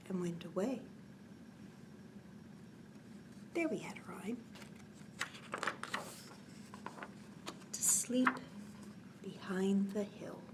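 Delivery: hushed whispered refrain. Acted. Storyteller to sleepy kids, whispering. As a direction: whisper it like a child is drifting off.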